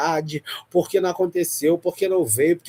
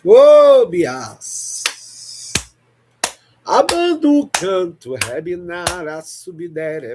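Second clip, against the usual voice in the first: ecstatic tongues; slower than usual. Real, not acted. Intercessor overcome in live prayer, ecstatic speaking in tongues.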